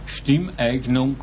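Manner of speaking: ordinary talk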